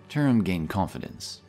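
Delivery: plain reading voice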